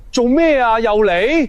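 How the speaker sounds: exasperated. Acted. Annoyed director, fed up.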